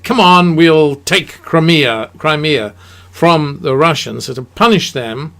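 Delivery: loud, eager call to grab Crimea; rousing the allies to seize it; mock rallying call. Allies urging each other on, loud eager rallying call. Allies plotting, voiced as a quote.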